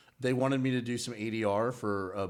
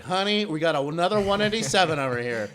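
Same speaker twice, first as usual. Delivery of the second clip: higher, louder camp cop voice; 6 semitones higher, 8 dB louder than usual. Shown as a demonstration. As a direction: deliver the cop line flamboyantly camp.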